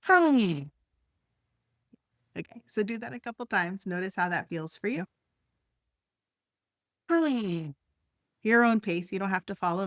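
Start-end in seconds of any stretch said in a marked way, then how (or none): 0.0-0.6 long falling sigh through the mouth; demonstrated exhaling sigh; audible sigh. Shown as a demonstration. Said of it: "do a sigh, like you're audibly breathing out". 7.1-7.7 easy falling sigh on the out-breath; demonstrated breathing sigh; audible sigh. Shown as a demonstration. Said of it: "do a sigh".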